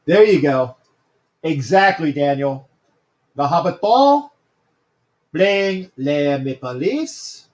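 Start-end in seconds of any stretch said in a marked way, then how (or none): 3.3-7.5 slow, hammed-up French accent; lightly put-on French accent; fake French accent. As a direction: drawl it like a mock Frenchman. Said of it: "as my fake French accent is going on".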